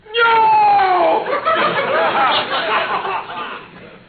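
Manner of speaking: a loud, high shout of laughter; loud high laughing whoop. Real, not acted. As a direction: burst out laughing at the cook. Laughs at the father's cooking.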